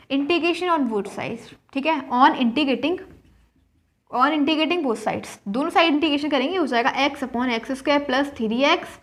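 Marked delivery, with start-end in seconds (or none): 0.1-5.4 slow dictation. Shown as a demonstration. Teacher dictating what to write.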